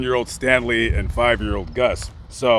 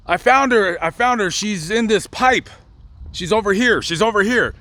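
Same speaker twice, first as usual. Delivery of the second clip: put-on high, raised call of a boy who found her; child yelling found; 6 semitones higher than usual. Boy shouting to family he found the girl, mock yell. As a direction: call out to the searchers, a touch higher. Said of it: "he yells".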